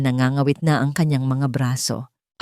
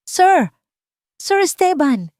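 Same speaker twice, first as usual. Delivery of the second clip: high, loud calling out with hope; hopeful shout; 12 semitones higher, 3 dB louder, slower than usual. Acted. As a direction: call out to him, full of hope. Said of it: "sigaw niya, puno ng pag-asa ang boses".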